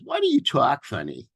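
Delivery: lightly imitated Boston schoolboy; Boston accent. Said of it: "here's my Boston accent here".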